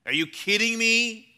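loud, high incredulous retort; outraged disbelief. Acted. Mourner answers Jesus' insensitive question, outraged. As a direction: throw it back in disbelief.

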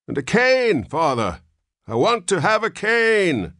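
put-on very high, loud old man's call; calling after him. A narrator's reading. Old son calling after his father down the hall, demanding. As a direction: shout down the hallway demanding.

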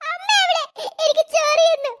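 put-on high child voice, whimpering about the itch; a small girl complaining she itches; cartoon child whining; whiny little girl. Itchy child calls for mother.

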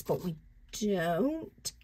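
statement ending like a question; drawn-out "don't" with a rising, unsure end; questioning tune. Real, not acted. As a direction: let "don't" swing upward as if asking. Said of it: "I said that like it was a question".

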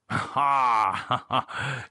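hearty gentleman's chuckle. A narrator's reading. Gerald amused by David's drink, chuckling. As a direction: chuckle in separate, jovial ha's. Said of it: "Gerald chuckled".

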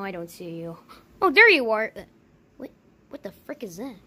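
ordinary delivery

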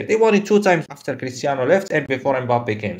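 ordinary delivery

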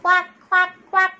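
high loud quacking; loud duck quacks. Shown as a demonstration. Teacher introducing the duck, loud high quacks.

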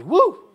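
high, loud show-off whoop; a mock-proud cheer at giving. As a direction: whoop like you're bragging.

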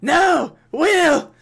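high, loud cry of grief as his man dies; anguished shout. Acted. General sees his soldier Will killed, shouting in anguish. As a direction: scream his name as he falls. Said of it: "General Donald goes".

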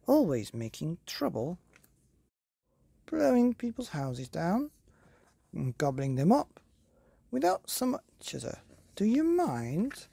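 high, prim scolding voice; fussy fairy godmother. Acted. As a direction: tut at the naughty wolves.

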